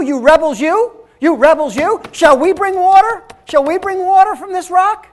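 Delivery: loud, high scolding of the rebels; angry Moses. Acted. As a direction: lash out at the people. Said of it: "Now he's angry at the people".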